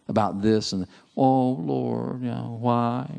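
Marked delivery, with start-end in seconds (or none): 1.2-3.1 whining. Retold or imitated.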